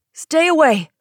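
high, loud panicked warning; terrified yell. A narrator's reading. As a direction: yell in terror to keep someone back. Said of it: "the kid yelled".

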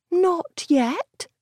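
light admitting voice. A narrator's reading. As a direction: admit it sheepishly. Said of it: "Cassandra had admitted".

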